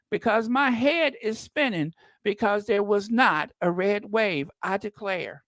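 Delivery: mock him as a fainting Southern belle. Quoting the senator on TV as a Southern belle. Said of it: "that Southern belle".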